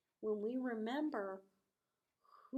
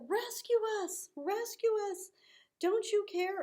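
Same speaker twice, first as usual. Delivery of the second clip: high, loud begging; acted fearful begging, high and loud; desperate plea; 10 semitones higher, 6 dB louder than usual. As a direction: cry out to be rescued. Friends begging Jesus.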